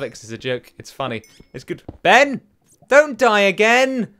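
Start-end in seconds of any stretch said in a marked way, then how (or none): 2.0-4.1 1 shouting. Real, not acted. Calling out to dying friend.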